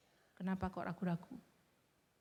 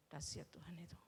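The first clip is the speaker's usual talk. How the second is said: whispered, much softer in reverence; awed whisper; 2 semitones lower, 12 dB quieter than usual. Real, not acted. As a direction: breathe it out in awe. Awe after miracle story.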